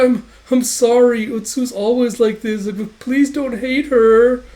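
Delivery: high, drawn-out mock-tearful whine; tearful pleading. Girl begging him not to hate her, tearful pleading. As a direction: plead loudly, close to acted tears. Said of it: "starts crying".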